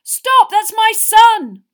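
very high, full-force, drawn-out shout; desperate yell. A narrator's reading. Father halting attack on his son, desperate yell. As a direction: scream out to protect your child. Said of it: "yelled a voice he recognised".

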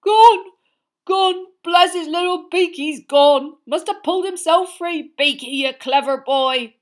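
loud, tearful joy; sobbing with relief. A narrator's reading. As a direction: blubber happily through tears. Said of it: "through his sobs".

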